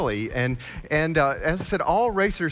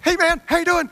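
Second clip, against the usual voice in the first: much higher, louder, overexcited greeting; squeaky overeager racer, pitched way up; Billy's greeting; 7 dB louder than usual. Retold or imitated. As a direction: play the hyper-energetic racer calling hello. Billy voiced in the story.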